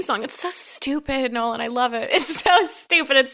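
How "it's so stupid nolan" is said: normal talking voice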